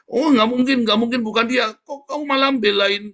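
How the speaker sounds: high, loud insistent mother; mother's voice rising, flatly refusing to listen; mother insisting. Retold or imitated. (ibu ngotot, suara tinggi dan keras)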